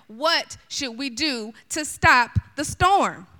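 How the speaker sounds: slow, drawn-out plea; desperate sailors. Acted. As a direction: stretch it out like frightened sailors. Desperate sailors asking Jonah.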